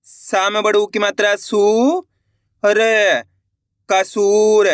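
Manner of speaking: ordinary delivery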